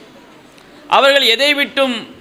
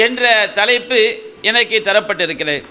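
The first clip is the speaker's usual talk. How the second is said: ordinary delivery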